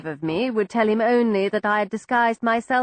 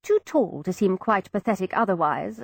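plain reading voice

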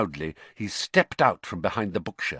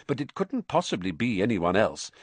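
plain reading voice

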